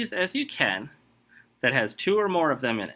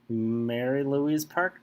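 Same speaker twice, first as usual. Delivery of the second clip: ordinary talk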